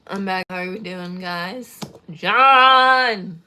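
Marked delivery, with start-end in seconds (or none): 2.1-3.4 loud delighted call; loud greeting. Real, not acted. Owner greeting John coming back, loud. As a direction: call out a happy welcome.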